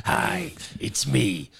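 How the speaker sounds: measured, deliberate self-introduction; Fauci impression. Imitating Fauci for friends, low slow New York voice. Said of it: "there's the, uh, Doctor Anthony Fauci".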